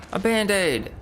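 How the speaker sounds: high, excited exclamation falling at the end; delighted discovery. Real, not acted. Gasps on finding an item.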